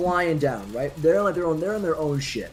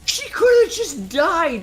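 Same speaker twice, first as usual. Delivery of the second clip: worked-up rant; 11 semitones higher, 4 dB louder than usual. Real, not acted. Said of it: "He's, he's so worked up".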